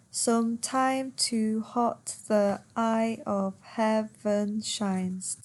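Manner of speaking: slow, syllable-by-syllable verse reading; per suku kata. Shown as a demonstration. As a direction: count out the line, one beat per syllable. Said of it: "Ten syllables once again".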